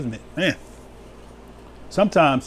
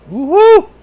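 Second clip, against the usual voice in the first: high, loud joyful woo-hoo; whoop; 10 dB louder than usual. Real, not acted. Preacher whooping to the congregation, high and loud. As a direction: let out a happy whoop. Rejoicing over reigning with him.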